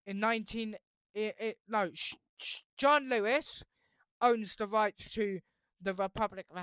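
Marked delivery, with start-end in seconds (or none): none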